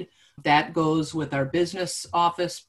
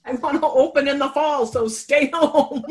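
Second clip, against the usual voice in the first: public service announcement; 9 semitones higher, 6 dB louder than usual. Pretend. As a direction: proclaim it like a laughing PSA announcer. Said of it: "Wait, say that again?".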